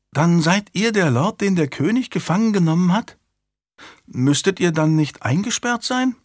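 put-on high-pitched child working it out; small girl questioning a captive lord; high, inquisitive child voice; curious little girl. A narrator's reading.

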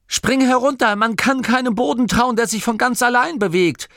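high, loud warning call; loud shout up the moving stairs; urgent shout. Acted. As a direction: call up to her in alarm. Masklin calls up the escalator.

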